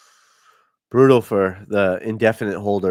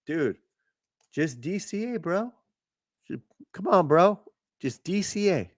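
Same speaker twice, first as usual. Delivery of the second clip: mocking bro voice; 8 semitones higher than usual. Mocking advice to dip buyers.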